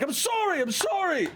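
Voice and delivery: high, pleading apology; high-pitched sorry sorry; apologetic guard. Acted. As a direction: grovel to the angry man. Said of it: "And he's like".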